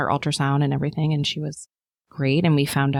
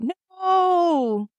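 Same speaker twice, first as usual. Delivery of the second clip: retold scream; 14 semitones higher than usual. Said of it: "mother-in-law screamed out".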